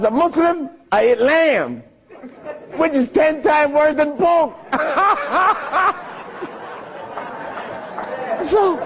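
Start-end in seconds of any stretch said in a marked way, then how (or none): 4.7-8.4 long laugh. Real, not acted. Laughs at his pork joke.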